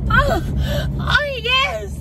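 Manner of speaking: high squeals at each jolt; excited squeal. Real, not acted. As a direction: shriek with delight over the bump. Car jolts on rough road.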